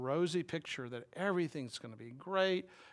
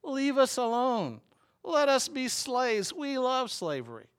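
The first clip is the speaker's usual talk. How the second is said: high, slow, whining plea; mock the grumblers in a high whine; high sarcastic whine of ungrateful slaves; mocking whiny Israelites; 8 semitones higher, 5 dB louder, slower than usual. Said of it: "this is, just cracks me up".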